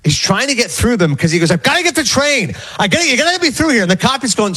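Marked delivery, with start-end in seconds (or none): 1.5-3.8 higher-pitched, rushed insistence; retold agitated protest; incensed. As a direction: imitate an irate man demanding to get through. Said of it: "and a guy's incensed".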